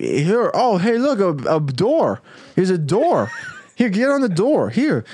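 high, eager hammed-up urging; eager helper. Jack urging James onto the floating door, high and eager. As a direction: hurry him onto the door. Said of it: "And Jack's like".

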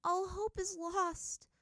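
put-on high, slow, hopeless girl's voice; Ty Lee giving up, sadly; sad mumble. A narrator's reading. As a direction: let the words sink in despair. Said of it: "Ty Lee mumbled sadly".

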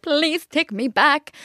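mock pleading. Mimicking ex begging to return, mock pleading. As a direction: drag out the plea mockingly.

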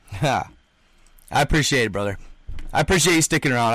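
normal talking voice

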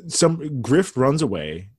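normal talking voice

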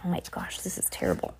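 hushed, horrified whisper. Real, not acted. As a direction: breathe it in dismay. Reader's own whispered aside.

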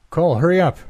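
high call across the street. A narrator's reading. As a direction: call out to a friend. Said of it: "Jack called to me".

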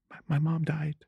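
high, hushed mock-tearful voice; fake crying. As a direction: quietly pretend to cry through it. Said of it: "which involved me crying".

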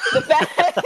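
slow villainous cackling laugh; Ursula laugh. Real, not acted. Friend jokes it is an Ursula laugh, villain cackle. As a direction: cackle like the sea witch. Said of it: "Ursula laugh".

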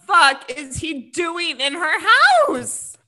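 loud, high, drawn-out exclamation; retelling her outrage at full volume; outraged disbelief. Retold or imitated. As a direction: stretch each word out in loud indignation. Acts out her own outraged reaction.

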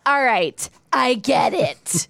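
single woman mocking a show-off wedding ring, sarcastic; mock sarcastic "I get it". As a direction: roll your eyes at the big wedding ring. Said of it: "where I'd be like".

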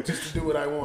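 ordinary delivery

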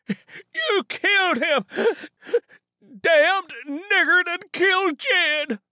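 high, loud, slow crying yell; old Ben wails over Jed. Acted. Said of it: "Old Ben yelled".

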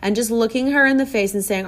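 ordinary delivery

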